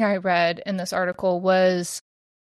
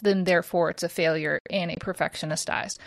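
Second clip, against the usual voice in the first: ordinary talk, nothing marked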